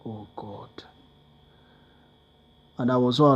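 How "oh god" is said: whispered in despair. Real, not acted. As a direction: let it out under your breath. No money for transport.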